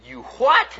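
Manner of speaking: high, loud disbelieving cry; astonished. Retold or imitated. Preacher stunned by the girl's words, high and loud. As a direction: blurt it out in shock. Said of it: "I remember sitting there saying".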